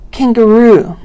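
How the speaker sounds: "Kangaroo" with the final ROO stressed; last-syllable stress. Shown as a demonstration. Teacher giving a stress example, last-syllable stress. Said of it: "has the last syllable stressed".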